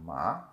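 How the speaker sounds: "Ma" gliding upward like a question; second tone, rising. Shown as a demonstration. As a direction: overpronounce "Ma" with a rising pitch. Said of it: "Like I'm overpronouncing".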